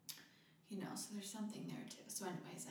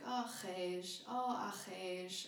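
slow, tender "Oh, khesh" as if your heart is touched; tender sigh; 4 dB louder, slower than usual. Shown as a demonstration. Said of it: "It's like".

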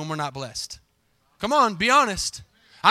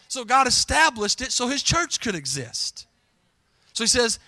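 normal talking voice

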